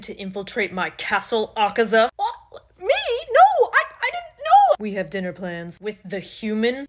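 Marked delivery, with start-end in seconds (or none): 2.2-4.8 very high, loud squeal of denial; terrified demon denying blame to his boss; squeaky panic. Acted. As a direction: squeal like a caught kid.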